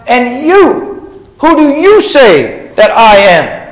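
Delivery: "you" high and held, then a loud, slow question; great emphasis on you. Acted. Jesus putting the question to his disciples, "you" stressed high and loud. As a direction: challenge them directly, leaning hard on "you". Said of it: "there's a great emphasis there".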